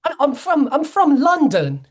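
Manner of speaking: high, loud mocking fake British accent; hammed-up bad London accent. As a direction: parody a terrible British accent. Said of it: "that British accent, man, is awful".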